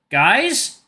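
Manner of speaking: high frightened call; scared call. Acted. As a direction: call out, afraid. Scared character calls out.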